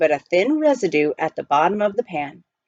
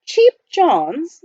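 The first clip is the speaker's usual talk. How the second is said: high rising puzzled question; puzzled echo; 9 semitones higher, 6 dB louder than usual. Acted. Butler echoing the miner's words in puzzled surprise. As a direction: repeat it back, baffled.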